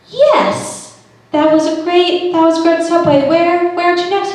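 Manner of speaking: high, forced cheerful yes; fake enthusiasm. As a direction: oversell it with a strained smile. Forced enthusiasm while lying.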